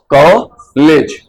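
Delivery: कॉलेज very slow, vowel gliding आ to ओ; drawn-out vowel. Shown as a demonstration. Teacher modeling कॉलेज for students, slow and stretched. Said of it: "इसी प्रकार से बोलना है".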